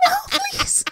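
very high, squeaky mock pleading; maggot voice. Imitating the maggot from Corpse Bride, high squeaky voice. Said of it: "talks like this".

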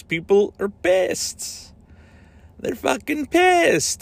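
high, drawn-out mock exasperation; mocking outrage. Real, not acted. Podcaster on angry Super Mario trailer reactions, high mocking outrage. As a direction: stretch it out high over the fuss.

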